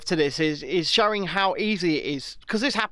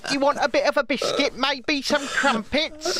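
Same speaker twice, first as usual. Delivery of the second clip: higher, hammed-up British host offering tea; mock British accent; 8 semitones higher than usual. Host mocking Meghan's accent with British offers. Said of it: "attempting British accent".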